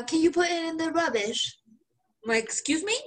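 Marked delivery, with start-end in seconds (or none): 0.0-1.6 higher-pitched mock English woman; imitated English coworker. English coworker asking host to bin it, imitated English accent. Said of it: "this English woman, and she tells me". 2.4-3.0 higher, questioning disbelief, retold; puzzled retort. Host retelling reply to English coworker, mock puzzlement.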